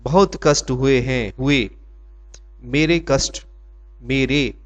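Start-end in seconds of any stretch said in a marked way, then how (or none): none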